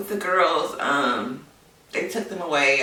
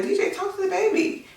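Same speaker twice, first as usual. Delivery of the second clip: high, urgent call to DJ; anxious plea; 3 dB louder than usual. Retold or imitated. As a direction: beg him to talk to the baby. Panicked plea to husband.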